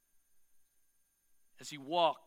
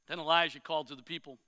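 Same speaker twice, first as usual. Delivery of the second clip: ordinary talk, nothing marked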